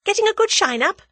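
bright, loud, high hospital-nurse greeting; cheery nurse voice. A narrator's reading. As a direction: chirp it like a breezy morning nurse. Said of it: "in the best approved hospital early morning style".

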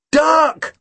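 sharp high warning shout. A narrator's reading. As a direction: yell it as the plane comes. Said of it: "I shouted".

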